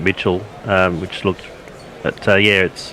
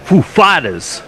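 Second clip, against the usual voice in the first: Walken voice; 6 semitones higher, slower than usual. Retold or imitated. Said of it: "in just a Walken accent".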